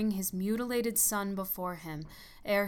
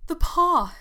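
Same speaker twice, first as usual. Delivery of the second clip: frantic, much higher and louder cry; wild cry; 10 semitones higher, 13 dB louder than usual. Acted. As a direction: cry it out wildly in sudden terror. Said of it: "she cried wildly".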